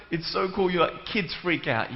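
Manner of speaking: ordinary delivery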